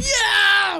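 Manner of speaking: loud, high crowd cheer; big movie-theater yell; retold cheer. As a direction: whoop it like a cheering audience. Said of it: "we cheered. We're like".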